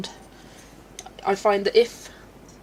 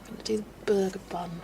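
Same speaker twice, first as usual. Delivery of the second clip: normal talking voice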